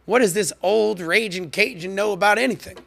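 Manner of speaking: lightly acted scornful question; skeptical viewer. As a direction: voice a dismissive sceptic. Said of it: "you might be saying".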